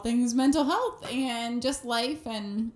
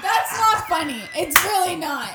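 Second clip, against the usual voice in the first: laughing scold; 6 semitones higher, 7 dB louder than usual. Real, not acted. Wife scolds husband, laughing.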